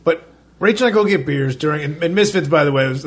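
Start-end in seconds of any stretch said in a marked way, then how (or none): none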